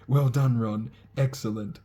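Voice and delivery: a proud prefect cheering his brother; loud congratulations. Acted. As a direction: boom it across the table.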